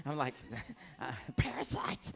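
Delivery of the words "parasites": horrified gasp word. Retold or imitated. Horrified reaction.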